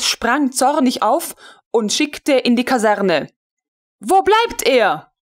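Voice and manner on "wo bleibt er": higher, slow impatient call; the colonel demands where he is. A narrator's reading. Colonel calls to her servant, impatient and higher. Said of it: "rief sie".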